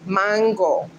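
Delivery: mango in a Spanish accent, falling at the end; one firm Spanish MAHN-go; Spanish pronunciation. Shown as a demonstration. Driving the point home to Luann, Spanish accent. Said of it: "how you pronounce it in Spanish".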